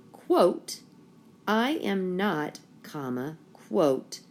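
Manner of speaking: reading out every punctuation mark; dictating. Shown as a demonstration. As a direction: dictate slowly, naming each mark. Reading punctuation aloud to class.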